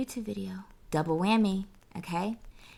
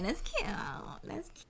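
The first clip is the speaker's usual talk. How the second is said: high, hushed fake approval; mock admiration; 9 semitones higher, 10 dB quieter than usual. Dismissing the booked-and-busy boast, mock admiration. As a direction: coo it high and small, insincerely. Sarcastic praise of being busy.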